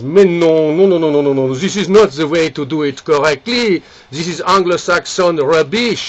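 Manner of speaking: put-on emphatic French-accented scolding. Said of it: "the Frenchman gets up".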